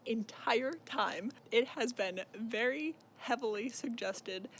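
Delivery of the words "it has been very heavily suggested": ordinary delivery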